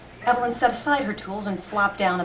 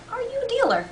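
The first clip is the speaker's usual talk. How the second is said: put-on much higher old man's question; old salesman asking. A narrator's reading. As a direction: ask it in a lightly put-on old voice. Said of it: "he asked".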